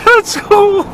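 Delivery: put-on squeaky high childlike voice; dopey delighted high voice. Continuing squeaky mock voice.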